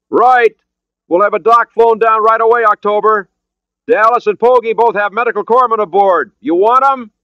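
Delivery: loud, high-pitched hailing voice; shouted reply. Acted. Boatman offering medical help to the submarine, shouting. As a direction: call it back across the water.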